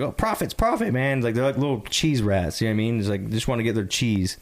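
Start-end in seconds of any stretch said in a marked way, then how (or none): 0.0-1.2 mocking reseller voice. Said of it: "They're just like".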